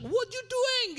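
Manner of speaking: loud, high, snapped officer's challenge; barked question. Retold or imitated. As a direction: bark it like a stern border officer. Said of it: "ketika digebrak". (petugas membentak dengan keras)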